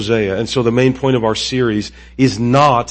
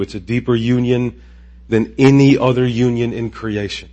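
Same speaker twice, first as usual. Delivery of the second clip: ordinary delivery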